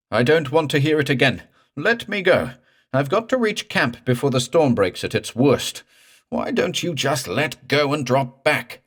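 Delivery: high, loud desperate plea; begging in terror; frantic pleading. Acted. Morse begs the ghost to let go, high and frantic.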